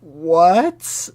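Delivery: high, stunned double take; real shock. Real, not acted. Reader stunned that breathing stopped.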